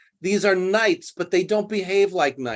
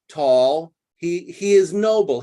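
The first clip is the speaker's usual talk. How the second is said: ordinary talk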